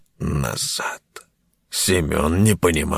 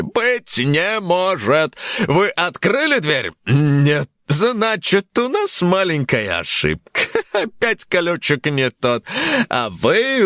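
game show host; 12 semitones higher than usual. Acted. Host voice continues.

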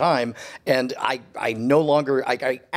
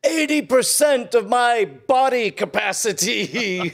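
mock tearful lament; 10 semitones higher than usual. Stricken comrade laments failing body, mock tearful. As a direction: ham up a pitiful dying wish. Acts stricken person's last wish.

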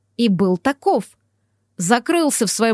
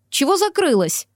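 higher-pitched, mildly surprised question; 5 semitones higher than usual. A narrator's reading. Colleague bursts into a locked reception, mildly surprised. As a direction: ask it with raised, puzzled pitch. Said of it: "удивилась Светочка".

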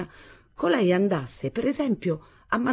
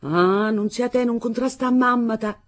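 higher, louder angry pity; mother scolding her daughter with pity; angry yet pitying; 6 semitones higher, 4 dB louder than usual. A narrator's reading. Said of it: "fra rabbiosa e impietosita".

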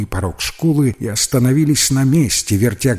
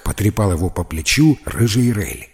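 usual speaking voice

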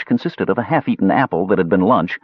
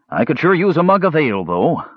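lightly voiced warrior, higher pitch; easygoing fighter wishing for a drink; 7 semitones higher than usual. A narrator's reading. Warrior in story dialogue.

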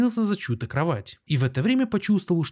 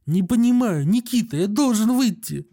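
a mild tremor, higher and louder; trembling voice; 7 semitones higher, 4 dB louder than usual. A narrator's reading. Doctor begs the guard to let him out, mildly trembling. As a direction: plead with rising panic. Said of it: "сказал он дрогнувшим голосом".

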